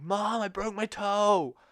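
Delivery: high, slow, wailing call to mom; imitating a sobbing child calling mom; crying kid. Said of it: "like, crying".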